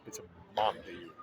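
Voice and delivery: low, soft mock mom voice; deadpan correction from mom. Quoting the ad mom to her son. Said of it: "It's like the Flonase commercial".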